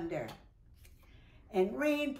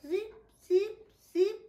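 snoring; 10 semitones higher, 4 dB louder than usual. Acted. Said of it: "Zippity snored".

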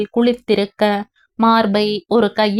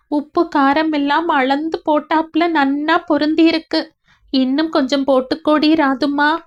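higher, tearful wife voice fighting back sobs; tearful young wife; 6 semitones higher than usual. Acted. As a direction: voice Radha near tears, remembering her mother-in-law. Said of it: "அழ ஆரம்பித்தாள்". (அழுகையை அடக்கி பேசும் மனைவி)

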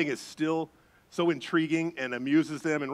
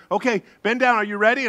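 little daughter; 6 semitones higher, 6 dB louder than usual. Retold or imitated. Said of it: "and she says".

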